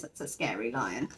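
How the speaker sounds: plain reading voice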